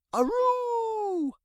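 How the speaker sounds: high howling aroo; dog howl. Acted. Otis the dog howls in alarm, high and drawn out. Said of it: "howled Otis".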